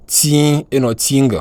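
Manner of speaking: Ting without the final G, drawn out slowly; Chinese pronunciation demo. Shown as a demonstration. Teacher contrasts Ting spoken slowly with no G. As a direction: say Ting slowly, not sounding the G. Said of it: "On ne sent pas le G".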